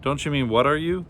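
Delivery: ordinary delivery, nothing marked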